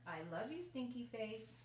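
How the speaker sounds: usual speaking voice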